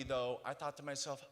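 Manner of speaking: normal talking voice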